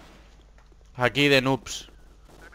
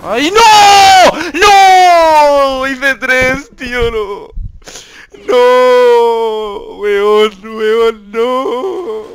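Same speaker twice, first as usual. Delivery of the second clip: high, loud cries of dismay; dismayed yell; 10 semitones higher, 11 dB louder, much slower than usual. Real, not acted. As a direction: wail as you lose it. Dies again in game.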